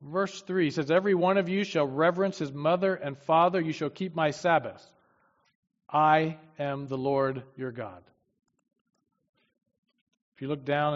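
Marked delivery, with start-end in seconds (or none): 0.9-8.0 louder, solemn Bible reading; preacher reading God's words to the congregation, louder and solemn; scripture reading. Acted. Preacher reads Leviticus to his congregation, solemn and louder.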